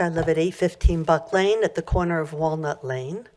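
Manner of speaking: usual speaking voice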